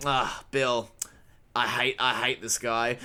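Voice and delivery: low, casual weariness at a neighbor; under-delivered. Acted. Acting out seeing a disliked neighbor, under-delivered. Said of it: "under-delivered it is".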